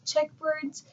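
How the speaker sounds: ordinary delivery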